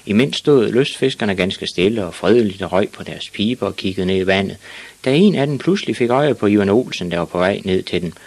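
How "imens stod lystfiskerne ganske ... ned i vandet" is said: usual speaking voice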